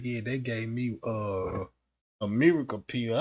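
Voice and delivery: low, quiet mock Trump. Comedian imitating Trump telling his secret, low and hushed. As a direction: drop way down and hush it like a secret.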